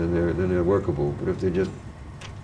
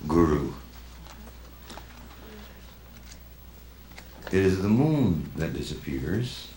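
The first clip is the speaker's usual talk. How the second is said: slow, softer, spaced-out recitation; poem reading; 5 dB quieter, slower than usual. Acted. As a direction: let each line hang in silence, quietly. Reads short poem Guru.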